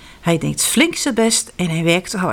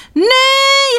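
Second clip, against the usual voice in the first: very high, loud shriek; 7 dB louder than usual. Acted. Janneke screams at Jip grabbing her doll, shrill. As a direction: scream it at the boy. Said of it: "gilt Janneke".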